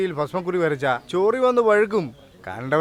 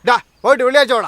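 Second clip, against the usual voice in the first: loud, high, quick brush-off; brusque dismissal; 7 semitones higher, 5 dB louder, faster than usual. Acted. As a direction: send a beggar packing. Dismisses the supposed beggar. (ഉറക്കെ, വേഗത്തിൽ ആട്ടിയോടിക്കുന്ന സ്വരം)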